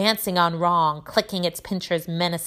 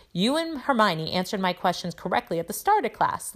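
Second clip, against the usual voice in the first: plain reading voice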